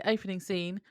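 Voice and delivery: usual speaking voice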